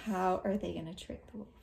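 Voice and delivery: usual speaking voice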